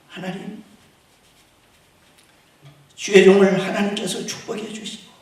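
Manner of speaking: ordinary delivery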